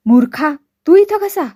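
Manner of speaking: sudden cry of shock, rising high and loud; a father startled to find his son home; astonished shout. A narrator's reading. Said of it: "विस्मयानं ओरडले". (विस्मयाने ओरडणे)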